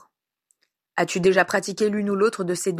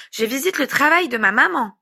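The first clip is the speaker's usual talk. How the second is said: put-on higher-pitched little boy announcing proudly; self-important child; 6 semitones higher, 4 dB louder than usual. A narrator's reading. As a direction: say it like a small child on official business. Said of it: "d'un ton important".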